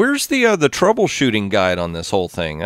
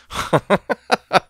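short, higher-pitched run of chuckles; 9 semitones higher than usual. Real, not acted. Player settling for close enough, chuckling. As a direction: chuckle it off in a quick, high string.

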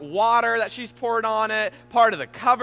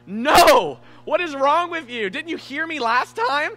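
raised, louder angry scolding; retold outburst at his daughter, higher and louder; angry yell; 6 semitones higher, 6 dB louder, slower than usual. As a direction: scold the toddler sharply, voice up and loud. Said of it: "I was angry".

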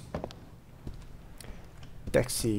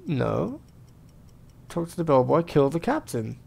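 normal talking voice

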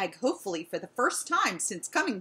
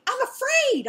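much higher and louder, a howling cry of fear; frightened howl; 7 dB louder than usual. A narrator's reading. Dog dragged into the loud shelter, howling in fear. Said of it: "I howled".

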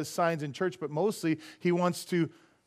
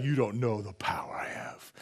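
low hushed ominous whisper; spooky whisper; 8 semitones lower, 7 dB quieter than usual. Retold or imitated. Imitating the spiritist's boast, low eerie whisper. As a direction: menace him in a low whisper. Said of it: "he's just like".

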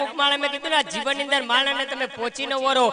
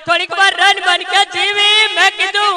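mock wailing lament; 5 semitones higher, 8 dB louder than usual. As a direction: wail it out like a hammed-up mourner. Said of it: "રડતા રડતા કહેતા હતા".

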